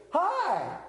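put-on high bright little girl hi. Preacher imitating a toddler greeting him, high. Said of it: "and she goes".

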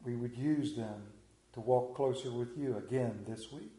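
hushed, reverent prayer voice fading low. Acted. Pastor praying for his listeners, hushed and reverent.

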